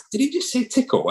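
can't believe what you just heard; disbelief. Retold or imitated. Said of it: "I'm like".

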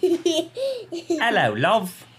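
high-pitched British imitation; exaggerated high Brit; mock British accent. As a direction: echo a British greeting in a high silly voice. Echoes her British greeting back.

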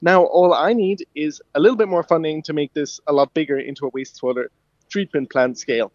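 normal talking voice

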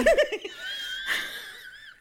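very high screaming laugh; shrieking laugh. Real, not acted. Cracks up at the friend's joke, shrieking laugh.

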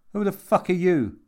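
loud, high-pitched demand; alarmed challenge. A narrator's reading. Jimmy confronts a stranger at his door, alarmed and loud.

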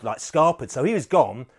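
ordinary delivery

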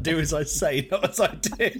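loud, lifted parody of a parent; mock scolding parent. Co-host teasing his mate for going full dad mode, mock parental. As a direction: play a preachy dad, breaking into laughter. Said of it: "That was the sort of parent in you".

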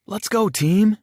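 high, loud team cheer; rally the team with a shout. A narrator's reading. Captain shouting to teammates, loud cheer. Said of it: "I shouted".

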